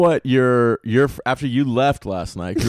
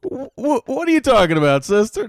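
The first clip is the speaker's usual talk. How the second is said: sassy mock shock; 9 semitones higher than usual. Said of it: "I was like".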